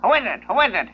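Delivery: high squawky parrot repeating itself. Acted. Parrot in the joke squawking at the plumber knocking at the door, high and repetitive. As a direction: play the parrot, pitched well up and chirpy.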